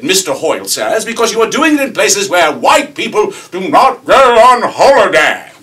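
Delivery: high, shouted British tirade; mock-indignant rant, building to a bellow; sputtering outrage. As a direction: sputter like a boiling kettle, imitating an irate young Brit. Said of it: "he sputters like a boiling tuber".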